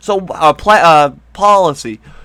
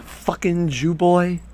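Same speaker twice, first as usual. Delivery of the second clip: slow, much softer imitation under the breath; mock muttered slur; 9 dB quieter, slower than usual. Imitating Hillary's hidden reaction, quiet slow mock. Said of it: "She's like".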